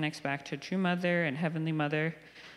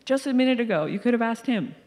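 higher and louder, half-joking exasperation; exasperated mom, raised and higher; mock exasperated mom; 6 semitones higher, 5 dB louder than usual. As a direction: push the kids off to Papa, fed up. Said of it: "And I'm like".

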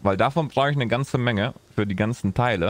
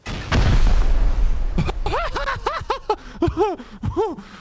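loud high laugh; 4 dB louder than usual. Acted. NPC tests fun, big laugh.

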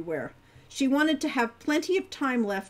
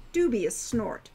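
plain reading voice

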